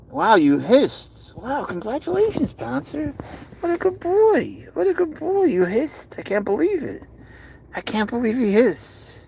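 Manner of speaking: very high-pitched cooing praise; gushing pet talk. Real, not acted. Owner congratulates cat on first hiss, high gushing pet talk.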